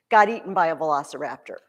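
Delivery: normal talking voice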